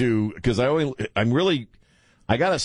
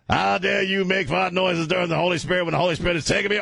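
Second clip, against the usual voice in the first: high, indignant preacher chant; hammed-up outraged sermon voice; radio preacher; 7 semitones higher than usual. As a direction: rebuke the heckler from the pulpit. Outraged preacher impression again.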